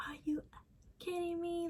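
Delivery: high, hushed mock outburst; high, quiet imitation of an incredulous brother; imitated angry brother. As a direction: squeal it softly like a muffled, mad brother. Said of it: "he's mad".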